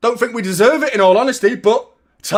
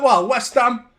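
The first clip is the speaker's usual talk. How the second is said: ordinary talk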